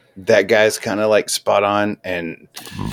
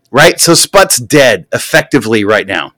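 ordinary talk

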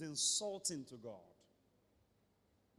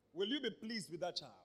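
ordinary talk

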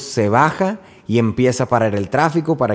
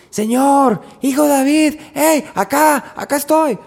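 much higher, insistent call for attention; persistent shouting; 13 semitones higher than usual. Acted. Mother pushing through the crowd to Jesus, insistent high calls. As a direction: wave her arms and yell to be seen. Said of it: "constante y perseverante en sus gritos".

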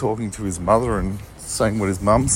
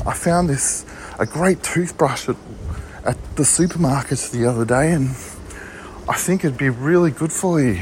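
higher, doting mum offering a gift; mum's voice; 4 semitones higher than usual. Retold or imitated. Mum tells her son about a toothbrush gift, higher doting voice. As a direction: sound like a sweet mum pleased with her present. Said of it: "I just channeled the spirit of my mum".

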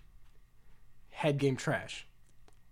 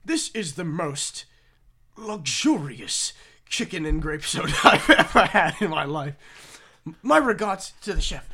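put-on posh British accent; 2 semitones higher, 7 dB louder than usual. Posh British man praising the chef, mild British accent. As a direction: play a refined British gentleman praising his meal in a British accent. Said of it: "he's got a British accent".

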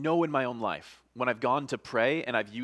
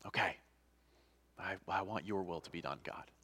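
low, very soft acceptance; resigned surrender; 5 semitones lower, 10 dB quieter than usual. Acted. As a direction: give in quietly, voice dropped. Acts giving in after refusal.

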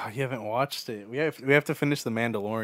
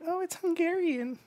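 put-on girlfriend's voice. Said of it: "She's like".

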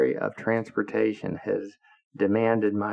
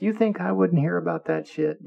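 higher-pitched mock indignation; retold indignant scolding; 9 semitones higher than usual. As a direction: act out an incredulous telling-off.

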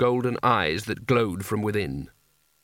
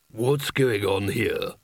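gruff wizard; 3 semitones lower, much slower than usual. A narrator's reading. Bilias arrives.

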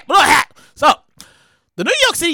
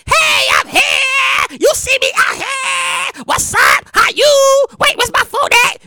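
much higher, slow, sing-song cheerful greeting; 11 semitones higher, slower than usual. Retold or imitated. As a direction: play the overly chipper diva arriving. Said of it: "[name]'s like".

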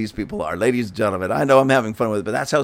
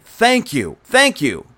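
higher-pitched, pointedly sarcastic thanks; 6 semitones higher, 3 dB louder, slower than usual. Real, not acted. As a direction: thank them with heavy sarcasm. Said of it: "I wanna say very sarcastically".

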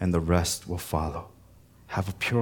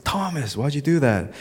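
higher, mock accusing call; 7 semitones higher than usual. Pastor retells blaming Thomas, mock accusing call. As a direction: fake blame on Thomas playfully.

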